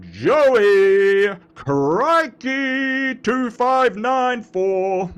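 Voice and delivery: loud, long-held announcer vowels; very slow, high announcer roll call. Pretend. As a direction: belt each name out like a ring announcer. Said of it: "announcer voice going".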